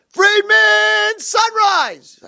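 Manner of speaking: very high, loud drawn-out holler; wake-up call. Retold or imitated. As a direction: rouse a sleeping roommate with excitement. Roommate calls out to wake him.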